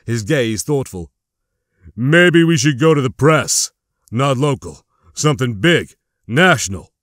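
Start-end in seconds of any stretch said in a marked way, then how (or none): 2.0-6.9 gruff voice. A narrator's reading. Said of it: "Barry's gruff voice broke the quiet".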